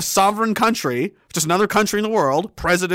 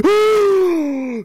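long, high hammed-up gasp; mock audience gasp; 10 semitones higher than usual. Imitating the audience seeing the vial, hammed-up shock. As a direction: gasp like a horrified crowd. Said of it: "a gasp was heard in the audience".

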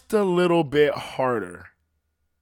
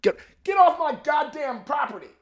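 high, strained yell at a loiterer; mock angry yell, pitched way up; acted yell; 10 semitones higher, 4 dB louder than usual. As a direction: yell it high, like chasing someone off. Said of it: "I might yell at you".

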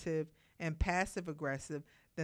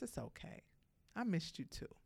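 much softer, gently comforting; soft reassurance; 8 dB quieter than usual. Retold or imitated. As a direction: hush it like soothing a pet. Boyfriend comforting the puppy.

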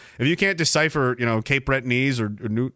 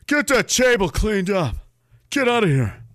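mock scolding; 6 semitones higher than usual. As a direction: boss the kid around with a laugh. Mock scolding a small child.

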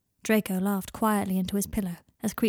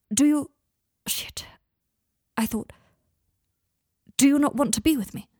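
higher and louder, rattled; anxious outburst; 6 semitones higher, 5 dB louder than usual. Acted. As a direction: blurt it out in panic. Draco panicking about rejection.

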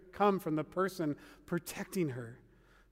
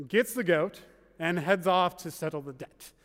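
ordinary talk, nothing marked

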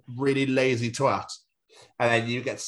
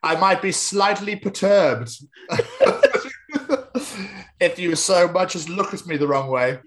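laughing; 6 semitones higher, 3 dB louder than usual. Real, not acted. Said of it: "laughing through it".

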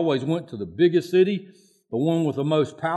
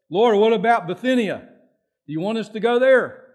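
raised, louder pleading prayer; acted prayer question; 8 semitones higher, 6 dB louder than usual. Paul seeking the Lord about Bithynia, acted prayerful asking. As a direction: ask God eagerly, pitched up. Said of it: "he prays again. He seeks the Lord".

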